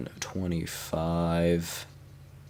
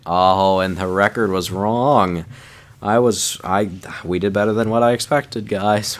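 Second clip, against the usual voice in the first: louder, excited disbelief; loud happy surprise at the record; surprised delight; 3 semitones higher, 8 dB louder than usual. Real, not acted. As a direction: react to a pleasant surprise out loud. Surprised reaction to the result.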